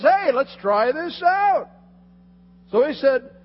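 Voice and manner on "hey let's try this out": high, loud, excited reasoning; eager Peter. Retold or imitated. Preacher voicing Peter's eager plan to the congregation. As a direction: talk yourself into it eagerly. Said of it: "So Peter just kinda says".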